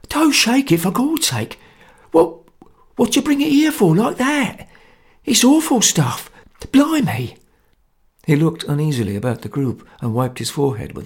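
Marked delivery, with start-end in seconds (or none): 0.1-7.4 much higher, fearful pleading; panicky. Acted. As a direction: panic over the bottle. Socher panicking.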